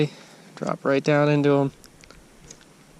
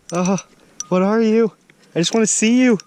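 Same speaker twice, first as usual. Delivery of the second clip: higher, eager call to the hooked fish; excited; 7 semitones higher, 4 dB louder than usual. Real, not acted. Angler reeling in, eager to see the fish. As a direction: coax the mystery fish up, thrilled.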